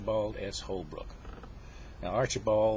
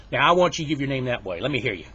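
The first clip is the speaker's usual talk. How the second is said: loud demand to hear it; stern teacher order; 3 semitones higher, 9 dB louder, faster than usual. Acted. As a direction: put the pupil on the spot, loudly. Teacher orders him to recite.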